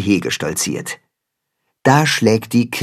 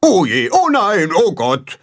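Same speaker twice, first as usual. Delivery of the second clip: high dismay easing down at the end; agitated; 7 semitones higher than usual. A narrator's reading. Gram panics as the farmer arrives, agitated and high. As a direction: fret aloud, starting high. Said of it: "schüttelt so aufgeregt seinen Kopf".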